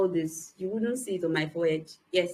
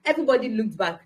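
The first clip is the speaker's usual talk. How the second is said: ordinary talk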